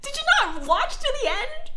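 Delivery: high, loud mock exasperation at the commenters; exasperated. Retelling her reply to commenters, high exasperated disbelief. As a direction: throw the question up high in disbelief. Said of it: "And I'm like".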